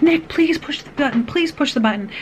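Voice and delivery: retold urgent plea, starting high and easing down; begging. Mother to son locked in the car, pleading. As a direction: re-enact begging a small child. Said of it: "I'm, like, begging him".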